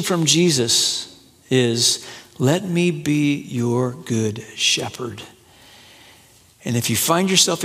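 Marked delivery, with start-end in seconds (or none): 2.4-5.2 softer, lower, slow gentle offer; tender invitation. Acted. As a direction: say it like a quiet promise. Jesus's tender invitation, softly voiced.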